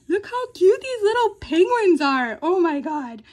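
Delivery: high, loud gushing squeal; overjoyed. Real, not acted. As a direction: squeal over something adorable. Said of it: "I am already, like, overjoyed".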